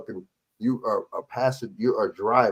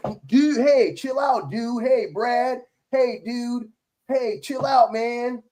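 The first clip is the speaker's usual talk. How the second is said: patronizing chill-out-bro imitation; mock a cop getting chummy, pitched high; mock chummy dude-speak; 8 semitones higher, much slower than usual.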